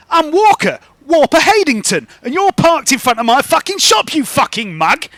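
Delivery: high, loud, belligerent shopkeeper; angry shopkeeper. Acted. Shopkeeper squaring up to the parked driver, belligerent.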